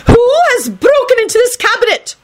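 very high, outraged shriek; shrill the question in indignation; shrill anger. A narrator's reading. McGonagall finding the broken cabinet, shrill anger. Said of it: "McGonagall shrilled angrily".

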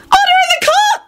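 very high, wailing elf voice; wailing elf scribe. A narrator's reading. Elf scribe tries to calm the court, wailing high. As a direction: wail for order like a frantic little elf. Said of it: "the elf scribe wailed".

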